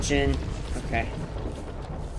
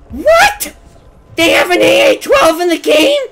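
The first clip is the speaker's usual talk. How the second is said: high shouted disbelief; shocked disbelief; 13 semitones higher, 8 dB louder than usual. Real, not acted. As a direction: shout it out in amazement. Gamer spots favorite gun.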